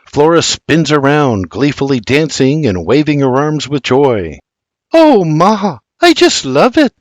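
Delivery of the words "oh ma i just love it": put-on much higher gleeful Scots girl; girlish Scots delight; joyful young lass. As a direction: spin and gush to your mother. Daughter twirling in new dress.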